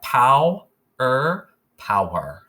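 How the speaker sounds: slow, punched "pow ... er ... power", each part apart first; teacher blending syllables aloud; syllables blended. Shown as a demonstration. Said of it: "let's put the first two syllables together".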